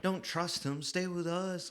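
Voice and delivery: high, soft mock pleading; pleading townsfolk. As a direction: imitate worried onlookers calling softly. Acting townspeople begging him to stay.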